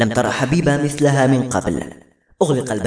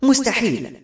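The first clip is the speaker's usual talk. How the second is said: sharp, much higher burst of disbelief; angry; 9 semitones higher than usual. A narrator's reading. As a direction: snap out the denial in anger. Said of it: "قالت بغضب".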